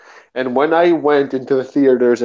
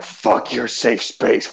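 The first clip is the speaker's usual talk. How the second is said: slow contempt; 4 semitones lower, 4 dB quieter, slower than usual. Pretend.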